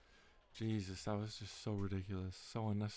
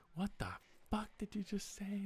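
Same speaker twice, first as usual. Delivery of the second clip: higher offended disbelief; 10 semitones higher than usual. Retold or imitated. Bartender turns on his friend, higher incredulous. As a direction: ask it stunned and offended. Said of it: "she's like".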